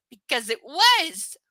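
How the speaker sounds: loud, slow, high mock outburst; hammed-up exclamation. Reader blurting the obvious answer, hammed-up exclamation. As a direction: belt out "was" long and loud.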